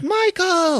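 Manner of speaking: very high, hammed-up exclamation; mock girl's cry. Liz recognising Mike, in a high mock girl voice. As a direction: squeal the name like a girl.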